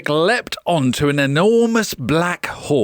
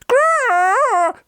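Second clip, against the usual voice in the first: high little growl. A narrator's reading. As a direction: growl like a shrunken knight. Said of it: "said Sir Roderick".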